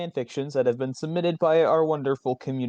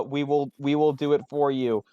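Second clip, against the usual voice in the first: normal talking voice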